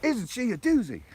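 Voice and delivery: high, loud bad Aussie accent; Crocodile Hunter. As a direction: ham up a terrible Australian presenter. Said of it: "that was a terrible Aussie accent".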